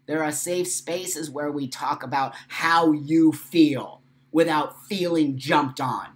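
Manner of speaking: loud, slow and emphatic; impassioned. Real, not acted. Urging her community, loud and slow. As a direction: lean hard into every word.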